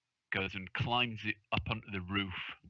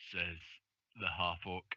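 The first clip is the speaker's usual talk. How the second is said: ordinary talk, nothing marked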